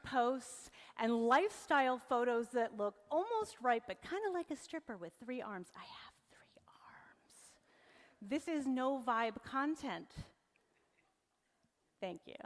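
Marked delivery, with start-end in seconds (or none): 5.8-7.5 slow, hushed whisper; whispered aside. Pretend. Speaker voices AI photo of three-armed stripper, slow whisper. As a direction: whisper it slowly as the figure in the photo.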